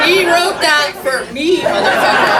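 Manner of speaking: loud laughing disbelief; real laugh at the dead friend's words. Real, not acted. Laughs at the line written for her.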